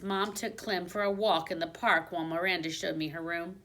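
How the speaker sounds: usual speaking voice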